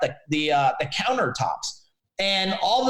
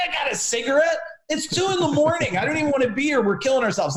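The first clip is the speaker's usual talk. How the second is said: higher, exasperated disbelief; a "you've got to be kidding me" reply; incredulous; 9 semitones higher, faster than usual. Retold or imitated. To a woman asking for a cigarette at 2am, incredulous and exasperated.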